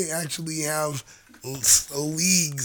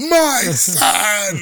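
mock dad wail; 4 semitones higher, 7 dB louder than usual. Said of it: "He falls to his knees".

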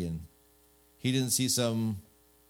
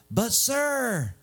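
high, whining protest; hammed-up, high-pitched whine; whiny; 11 semitones higher, 3 dB louder than usual. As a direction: whine it like a put-upon child. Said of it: "he kinda gets all whiny".

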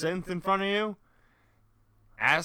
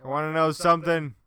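loud mock provocation; 3 semitones higher, 10 dB louder than usual. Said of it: "and you're like".

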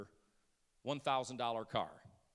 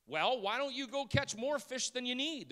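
pushy rich man, lightly voiced, higher; a hint of the pushy rich man, pitched up; rich man asking; 10 semitones higher, 5 dB louder than usual. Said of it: "the rich man asked".

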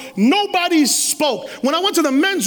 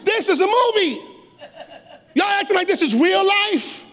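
incredulous; 8 semitones higher than usual. Retold or imitated. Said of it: "And I'm saying".

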